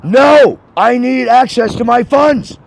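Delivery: loud, high, desperate shouting; furious shouting. Real, not acted. Customer shouting at the bank agent not to shut off the card, furious. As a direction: yell to stop them cutting the card.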